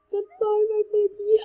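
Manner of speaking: hammed-up fake sob goodbye; mock crying. Kid mock-crying goodbye to lost item, hammed-up sob. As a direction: wail it like a fake heartbroken parent. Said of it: "I'm gonna cry to death".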